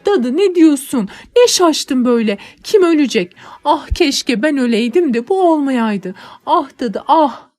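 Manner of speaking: put-on girl sobbing out her grief to nanny; anguished lament. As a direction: wail out your heartbreak.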